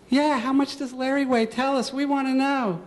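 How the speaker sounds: high, teasing classroom taunt; mocking bully. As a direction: jeer like a smug schoolboy. Girl joins taunting.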